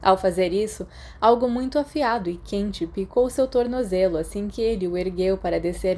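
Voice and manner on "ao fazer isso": usual speaking voice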